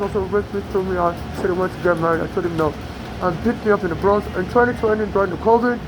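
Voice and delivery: ordinary talk, nothing marked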